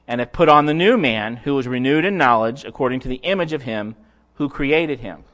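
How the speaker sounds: loud, measured reading of scripture. Acted. Preacher reading Colossians to the church, a loud solemn recitation. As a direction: read the passage out to the congregation, weighty and loud.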